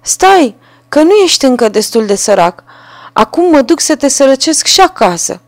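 high, loud little-bird threat; a tiny bird promising ruin; threatening sparrow. A narrator's reading. Sparrow warning the carter it will ruin his home too. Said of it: "a zis Vrabia".

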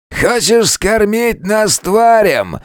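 high slow sneer from a cage; bandit voice. A narrator's reading. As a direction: sneer it out slowly like a caged bandit. (насмешливый голос бандита)

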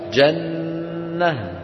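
very slow chanted جنة ending in h; pausal form. Shown as a demonstration. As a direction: draw out جنة and close on h. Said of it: "جنة يوقف عليها".

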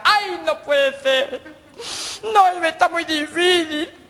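high, hammed-up tearful wail; panicked whimpering. Schoolboy realizes he forgot his colour, panicked whimpering. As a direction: wail like a child in trouble.

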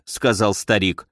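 usual speaking voice

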